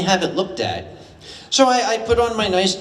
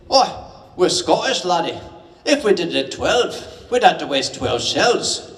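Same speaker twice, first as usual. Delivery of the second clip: Scottish sergeant. Retold or imitated. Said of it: "he said".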